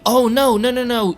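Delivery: sudden high, loud oh no; hammed-up alarm at new chore; mock panic. Mock panic over more chores.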